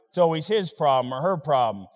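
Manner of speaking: usual speaking voice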